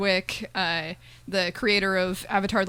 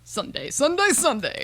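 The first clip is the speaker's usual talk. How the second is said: monster truck announcer; 6 semitones higher than usual. Pretend.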